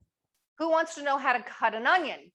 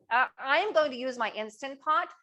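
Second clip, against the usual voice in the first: usual speaking voice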